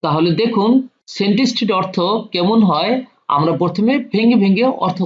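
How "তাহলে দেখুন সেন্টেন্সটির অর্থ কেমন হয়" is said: usual speaking voice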